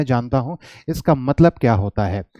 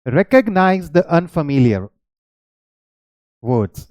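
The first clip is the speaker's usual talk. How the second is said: slow dictation; 2 semitones higher, much slower than usual. Shown as a demonstration. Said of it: "third step क्या है?".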